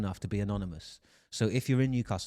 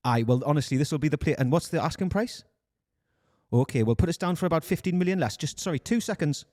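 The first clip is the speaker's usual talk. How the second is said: put-on Newcastle accent; 5 semitones higher than usual. Recruiter phoning a player's agent, Newcastle accent. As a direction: put on a Geordie accent, slightly raised. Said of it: "Newcastle accent than this".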